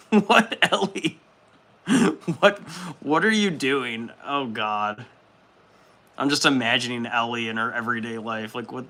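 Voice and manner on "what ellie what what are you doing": baffled. Real, not acted. Baffled at chat comment.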